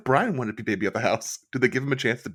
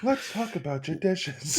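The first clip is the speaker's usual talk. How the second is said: slow, drawn-out mock TV host line; mocking Padma; 7 semitones higher, 4 dB quieter, slower than usual. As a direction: parody the flat dubbed host read. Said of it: "that awful A D R of her being like".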